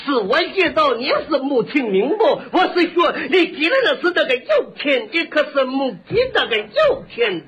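laboured Shandong dialect explanation; exaggerated Shandong shopkeeper; Shandong butcher. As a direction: argue until the tongue tangles in Shandong speech. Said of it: "他这舌头受不了了".

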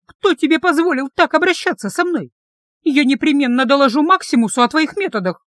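outraged, pitch up. A narrator's reading. Heroine threatening to report the guard, outraged.

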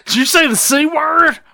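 high, scandalized gasp of a question; mock shocked. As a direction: act appalled at a bad word. Mimics shocked local people.

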